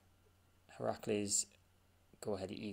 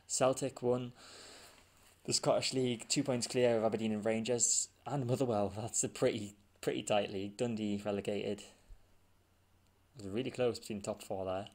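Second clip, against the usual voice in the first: louder, hammed-up Scottish accent reading the table; mock Scottish accent; 5 dB louder than usual. Gamer can't stop his jokey Scottish accent while reading results, loud mock Scottish accent. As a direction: keep the bad Scottish accent going, booming. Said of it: "I can't stop".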